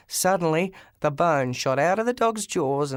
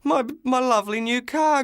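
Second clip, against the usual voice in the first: groaned; 7 semitones higher than usual. Acted. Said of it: "groaned the driver".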